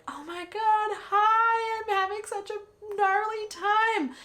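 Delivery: put-on high, bright valley-girl squeak; mock inner voice. Runner thinking to herself mid-race, high mock chirp. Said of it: "I remember thinking to myself".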